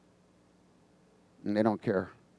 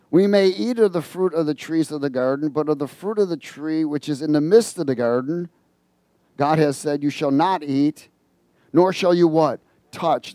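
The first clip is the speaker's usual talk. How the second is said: scripture reading; 2 semitones higher, 4 dB louder than usual. Acted. Reads Genesis aloud.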